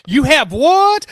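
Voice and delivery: sarcastic loud what; mock loud question. Real, not acted. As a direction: call out as if over noise. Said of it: "couldn't hear you over the mumbling".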